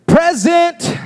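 high, ringing shout; shouted title. Shown as a demonstration. Preacher announces the message title to church, calling out. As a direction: announce it like answering roll call.

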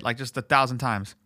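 ordinary talk, nothing marked

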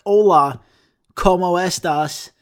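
mock English-accented Spanish greeting, slow. Mocking non-native greeting to Spanish people, English accent. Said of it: "English accents".

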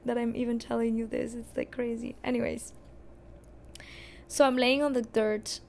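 normal talking voice

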